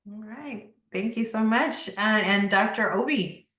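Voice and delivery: normal talking voice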